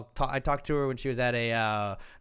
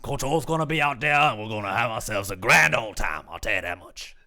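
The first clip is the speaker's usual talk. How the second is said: Cajun character voice. Pretend. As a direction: ham up a Water Boy Cajun. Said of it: "combine all the characters from The Water Boy".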